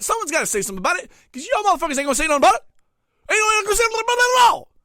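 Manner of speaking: very fast, rising furious tirade; fast angry rant. Acted. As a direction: rattle it off, building to a yell. Rant speeds into outburst.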